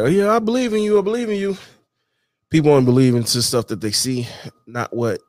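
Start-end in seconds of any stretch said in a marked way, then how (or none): 0.0-1.6 a sing-song, higher take of fake support; mock encouragement. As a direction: lightly parrot hollow cheerleaders. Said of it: "they're like".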